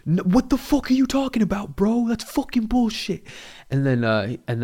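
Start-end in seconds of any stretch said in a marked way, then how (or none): 0.1-3.3 high, mocking angry outburst; imitated angry rant. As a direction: play the guy losing it over a call. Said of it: "he locks on to O G".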